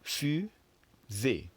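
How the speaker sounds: fusée stretched, fu then ze falling; teacher modelling fusée for repetition; slow French model. Shown as a demonstration. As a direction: say fusée slowly and clearly. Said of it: "Let's try it again".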